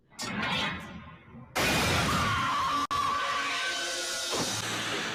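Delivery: big loud laugh; loud burst of laughter. Real, not acted. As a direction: crack up loudly. Laughing at Snape scene.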